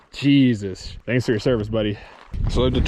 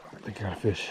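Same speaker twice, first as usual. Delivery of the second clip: hushed; 6 semitones lower, 12 dB quieter, faster than usual. Real, not acted. Noticing a bite, hushing.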